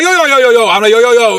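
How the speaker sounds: high, loud, rapid-fire alarm; panicked pleading. Retold or imitated. Storyteller to man pointing a gun, panicked pleading. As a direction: blurt it out to stop someone shooting.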